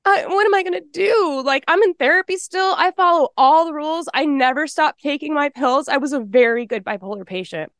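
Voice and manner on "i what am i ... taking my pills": much higher, desperate inner panic; panicked thoughts. Retold or imitated. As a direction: voice the shock as it hits. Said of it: "because I thought".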